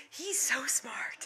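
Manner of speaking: whispered aside, slow. Real, not acted. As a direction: whisper it proudly to the camera. Aside about the dog.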